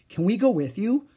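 put-on little girl asking to tag along; eager girl. A narrator's reading.

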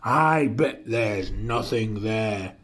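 low, mildly dismissive scoff; understated storybook scorn. A narrator's reading.

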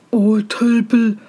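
put-on weary, disdainful older-brother voice; scornful brothers. A narrator's reading. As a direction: sigh at the fool, lightly in character.

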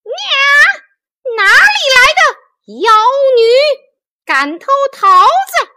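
very high, very loud monkey yell; loud outraged shout. A narrator's reading. Monkey King catching fairies stealing peaches, shouting. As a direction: roar at the thieves at full force. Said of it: "大叫一声".

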